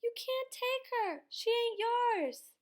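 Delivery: very high, loud, desperate scream; boy screaming to stop his father. A narrator's reading. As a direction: shriek it, stretching the end. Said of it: "Bryce screamed".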